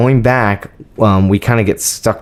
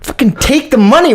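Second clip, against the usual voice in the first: usual speaking voice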